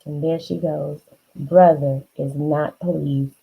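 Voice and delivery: usual speaking voice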